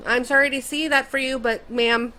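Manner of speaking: usual speaking voice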